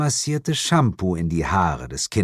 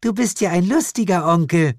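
hohe, kichernde Kinderstimme; kicherndes Mädchen; 9 semitones higher, faster than usual. Acted. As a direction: als kleines Mädchen kichernd. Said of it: "Das Mädchen kicherte". (kleines Mädchen kichert über den Onkel, hohe Kinderstimme)

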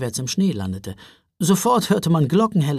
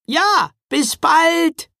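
high, loud, long-drawn call; gingerbread man calling back; 14 semitones higher, 6 dB louder, much slower than usual. A narrator's reading. Gingerbread man answering a farewell, calling out high. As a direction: holler it back slowly from afar. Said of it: "antwortete der Lebkuchenmann".

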